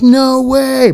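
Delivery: high, drawn-out disbelief; imitated amazement. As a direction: mimic astonished buddies, pitch way up. Retold buddies' amazed reaction.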